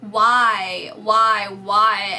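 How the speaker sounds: loud anguished cry of why; loud pleading shout. Pretend. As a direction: cry it out into the universe. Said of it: "You're shouting it out into the universe".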